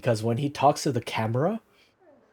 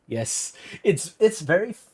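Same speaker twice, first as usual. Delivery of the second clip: normal talking voice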